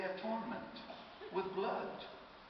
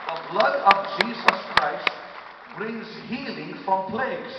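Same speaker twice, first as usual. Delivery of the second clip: loud, slow, emphatic sermon delivery; forceful preaching; 4 semitones higher, 7 dB louder than usual. Real, not acted. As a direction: drive each phrase home to the congregation.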